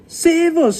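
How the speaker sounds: loud, high cry for help; scream. A narrator's reading. Terrified giant thrashing in his sleep, screaming. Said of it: "screamed the flesh".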